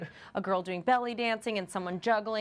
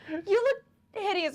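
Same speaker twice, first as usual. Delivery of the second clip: mock crying insult; 10 semitones higher, 3 dB louder than usual. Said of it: "and she's crying".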